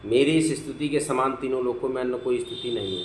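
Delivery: normal talking voice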